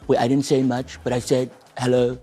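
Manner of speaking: low, slow Trump voice; Donald Trump impression. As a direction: drawl believe me like Trump. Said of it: "you mean Donald? Believe me".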